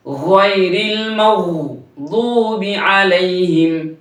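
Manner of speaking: slow melodic tajweed chant; slow sung Quran recitation. Shown as a demonstration. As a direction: draw each word out, recite.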